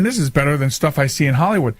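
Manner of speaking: ordinary talk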